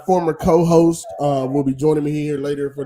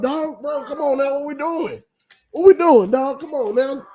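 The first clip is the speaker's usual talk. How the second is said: exasperated pleading; 9 semitones higher than usual. Real, not acted. Exasperated at quarterback price hikes.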